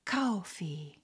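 put-on breathy whisper of the TikTok girl; imitating her whisper. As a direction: hush the word like the clip. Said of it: "she pours it into the strawberry".

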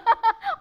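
quick loud high laugh; short laugh. Real, not acted. Doctor laughing at her diploma reply, short laugh. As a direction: laughs at her own comeback.

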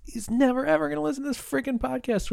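playful high-pitched mock tone; mock high squeaky voice. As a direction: squeak the aside out in a goofy falsetto. Repeats aside in mock voice.

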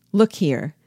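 ordinary delivery

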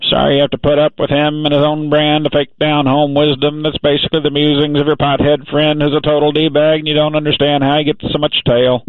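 normal talking voice

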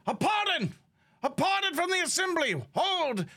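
high, urgent herald's cry; messenger crying a pardon. Acted. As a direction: call it out high, halting an execution. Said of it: "It's like".

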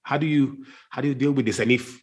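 ordinary talk, nothing marked

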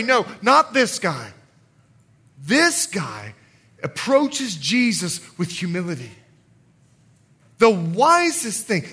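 ordinary delivery

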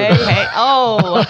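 put-on slow, high crotchety old lady; hammy old lady exclamation. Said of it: "I'll put my old lady voice on".

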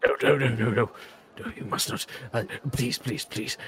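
fading to a breathless hush; frantic hushed plea. Acted. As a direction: beg them in a hushed panic. Servant begging them not to.

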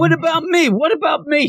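high-pitched mock yell. Ignored Sayori calling out to be noticed, high mock yell. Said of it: "She keeps yelling".

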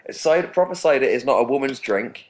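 normal talking voice

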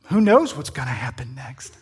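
whispered, as if telling a secret; suspenseful whisper. Acted. Preacher teasing the congregation about a mystery call, whispered. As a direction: hush it like a suspense story.

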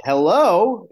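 high, loud retold excited greeting; very excited hello. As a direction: act out answering the phone thrilled. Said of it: "in a very excited tone".

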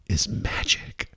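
hushed, slow mystical whisper; theatrical whisper. Pretend. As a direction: whisper it like a secret reveal. Theatrical punchline to his answer.

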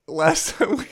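real helpless laughter, voice shooting high; cracking up. Real, not acted. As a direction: try to start through the giggles. Cracks up at own joke.

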